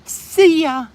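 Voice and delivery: high, squeaky goodbye on behalf of the pet; playful high-pitched pet voice saying bye; cutesy goodbye voice. Pretend. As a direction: chirp a bright little see ya. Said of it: "Say goodbye".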